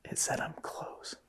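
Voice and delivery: very soft, voiceless whisper; spooked whisper. Real, not acted. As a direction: whisper it barely aloud. Whispered report of spirit box.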